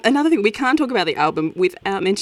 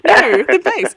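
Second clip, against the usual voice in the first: high, slow, gushing self-corrections; each instrument topping the last, way up high; correcting himself excitedly; 12 semitones higher, 4 dB louder, slower than usual. Retold or imitated. Interviewer recalling first listen, gushing high and slow. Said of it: "Then I went".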